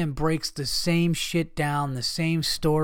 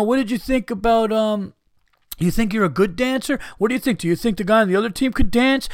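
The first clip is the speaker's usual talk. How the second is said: higher, mocking reporter voice; 5 semitones higher than usual. Mocking media day reporters, he apes a silly question.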